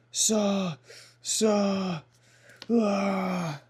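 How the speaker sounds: high pained saw, saw, ah; wincing from a paper cut. Real, not acted. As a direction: yelp from a small cut. Just cut finger.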